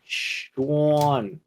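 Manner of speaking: SEAN said as "Shhh-awwn"; drawn-out SEAN. Shown as a demonstration. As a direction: stretch SEAN out long with a rounded "aw". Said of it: "gotta say it like that".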